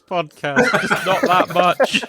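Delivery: real amused protest; laughing. Real, not acted. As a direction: tease back through laughter. Teasing reply while laughing.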